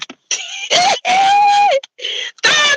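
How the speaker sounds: high screaming cackle of delight. Real, not acted. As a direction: shriek with laughter at the clip. Cackling at the fight video.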